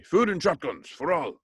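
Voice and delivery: hammed-up revolutionary battle cry; Russian revolutionary. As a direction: proclaim it like a rebel slogan, joking. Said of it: "I sound like some Ru-, Russian revolutionary".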